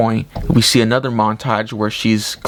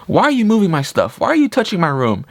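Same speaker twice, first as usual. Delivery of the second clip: higher-pitched retold telling-off; mock scolding; 8 semitones higher, faster than usual. Man scolding the woman who moved his things, mock indignant.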